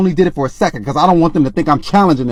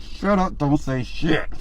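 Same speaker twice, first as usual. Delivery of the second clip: hushed order; 2 semitones lower, 10 dB quieter, slower than usual. Retold or imitated. To her fiance as the men approach, much softer hushed order. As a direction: warn him under your breath.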